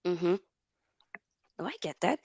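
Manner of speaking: ordinary talk, nothing marked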